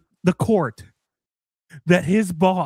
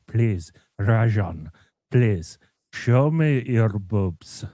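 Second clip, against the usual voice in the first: mock begging boss; 8 semitones lower, slower than usual. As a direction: lower and slow, hammed-up begging. Boss voiced begging, low.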